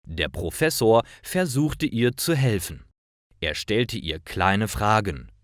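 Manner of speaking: normal talking voice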